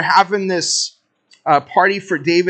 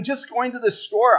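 normal talking voice

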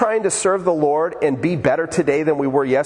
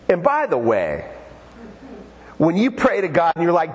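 usual speaking voice

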